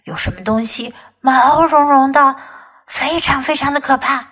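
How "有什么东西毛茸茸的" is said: ordinary delivery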